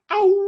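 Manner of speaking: very high, loud, sustained howl; dog howl. Retold or imitated. Dog howls while the old man gives directions, long and loud. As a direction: throw your head into a long hound howl. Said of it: "the dog goes".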